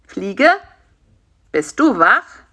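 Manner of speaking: a call to rouse someone. A narrator's reading. Karl-Heinz checks whether his fly friend is awake, calling out. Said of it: "rief Karl-Heinz".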